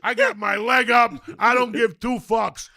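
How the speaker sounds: real giddy shouting; ecstatic raving. Real, not acted. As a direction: rave like a true believer. Still raving, laughing.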